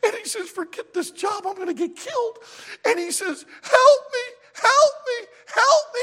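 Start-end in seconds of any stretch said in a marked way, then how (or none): 0.5-2.4 high-pitched mock panic; freaking out. Gorilla guy facing the lions, panicked high voice. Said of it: "he freaks out". 3.6-6.0 loud, very high cries for help; mock panicked screaming. Gorilla guy among the lions, screaming in panic. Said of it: "he freaks out".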